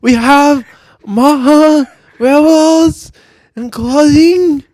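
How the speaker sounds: loud, high, slow mock Japanese accent; exaggerated high-pitched Japanese movie accent. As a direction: declaim it haltingly in a hammed-up Japanese accent.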